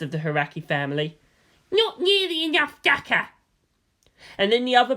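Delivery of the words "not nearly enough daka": put-on little girl voice. Youngest girl comments.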